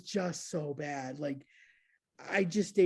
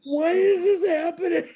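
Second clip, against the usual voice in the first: high, loud panicked squeal; panicked shriek; 12 semitones higher, 8 dB louder than usual. Retold or imitated. High panicked squeal while throwing up in the car.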